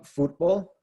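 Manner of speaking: football said slowly, stressed on foot-; Spanish football, leaning on the first syllable; stress second to last. Shown as a demonstration. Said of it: "on the second to last syllable".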